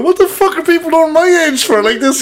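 high-pitched mock outrage; pissed-off rant. Retelling a man annoyed about his age, mock pissed-off. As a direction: imitate an indignant man at home, amused. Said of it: "Your man was so pissed off".

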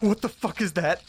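startled, voice jumping up high; sudden shocked swear at a creepy bug; shocked outburst. Acted. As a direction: recoil at the sight of it. Discovers a strange huge bug.

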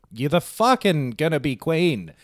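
imitated fed-up father. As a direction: act sick of having to tell her. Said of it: "I'd be sick of it if I was him too".

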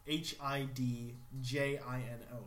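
spelling out. Shown as a demonstration. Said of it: "I don't know how to pronounce it".

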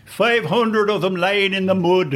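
high, slow mother's lament; mournful mother. Retold or imitated. Actor mother mourns the slaughter, high and slow. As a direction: wail it like a grieving mother. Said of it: "one mother voice saying".

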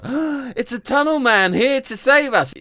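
put-on high, loud childlike relief; mock awed kids; high squeaky kids, grateful; relieved child voice. Children greeting rescuer.

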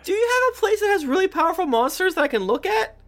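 very high, fast, excitable squeak; high-pitched goofy character; squeaky character voice. Acted. Plays character Ron.

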